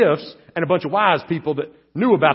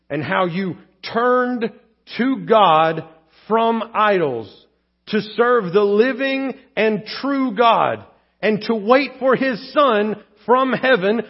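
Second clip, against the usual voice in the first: slow, rising solemn reading; scripture reading; 3 semitones higher than usual. Acted. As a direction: read the verse with mounting reverence. Reads scripture aloud.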